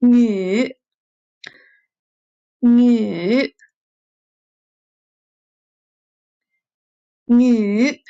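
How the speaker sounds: nü in third tone, falling then rising. Shown as a demonstration. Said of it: "when they read at third tone".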